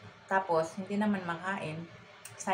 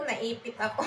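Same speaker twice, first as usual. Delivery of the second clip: usual speaking voice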